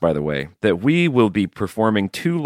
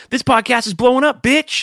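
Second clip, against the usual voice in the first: much higher squealing brag; hype boast; 4 dB louder than usual. Real, not acted. Host bragging the podcast is blowing up, high squealing hype. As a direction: yell it like a hype man.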